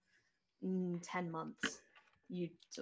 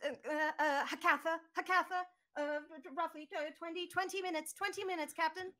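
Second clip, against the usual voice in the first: high, stammering robot computing; a jittery droid reading out the numbers; nervous robot; 9 semitones higher, faster than usual. Acted. Game master voicing BB reporting to the captain, high and stammering.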